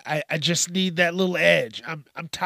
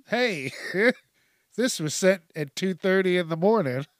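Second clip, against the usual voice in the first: laughing; 3 semitones higher than usual. Retold or imitated. Imagined friends mocking.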